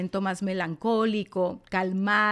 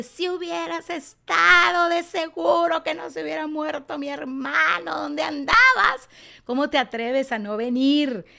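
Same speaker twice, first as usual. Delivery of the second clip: higher, louder, accusing Martha voice; angry reproach; 7 semitones higher, 4 dB louder than usual. Retold or imitated. Preacher voicing Martha scolding Jesus, angry reproach. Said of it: "una mujer colérica que le reclama".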